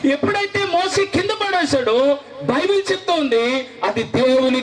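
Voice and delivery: high, pleading preacher's call, pitched well up; fervent altar call. Acted. Preacher calling youth to give their lives, high pleading cry. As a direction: urge the young people to surrender.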